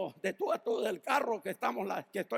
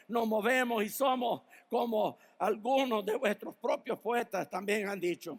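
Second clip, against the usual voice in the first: normal talking voice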